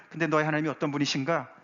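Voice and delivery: ordinary delivery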